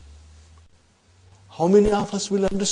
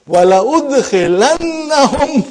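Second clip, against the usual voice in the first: higher, louder and fast, full force; intense emphasis; 8 semitones higher, 9 dB louder, much faster than usual. Shown as a demonstration. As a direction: hurl the word out with power. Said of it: "the power of and intensity".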